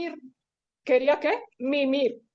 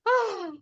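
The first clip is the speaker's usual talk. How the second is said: big audible acted sigh; sighing aloud for the children; demonstrative sigh; 7 semitones higher than usual. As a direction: let out a high, exaggerated sigh. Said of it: "suspiró, como cuando ustedes suspiran".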